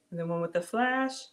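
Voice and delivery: ordinary talk, nothing marked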